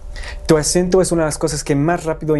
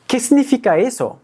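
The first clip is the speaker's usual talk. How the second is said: confused; 8 semitones higher than usual. Pretend. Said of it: "confused learner".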